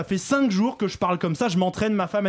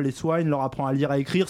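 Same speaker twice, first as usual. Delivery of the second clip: usual speaking voice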